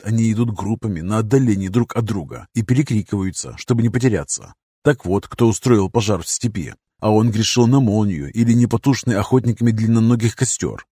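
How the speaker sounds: plain reading voice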